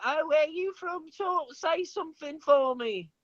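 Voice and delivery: high-pitched mock voice of curious strangers; nosy strangers. Imitating people he meets asking where he's from, high mocking voice. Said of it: "they're like".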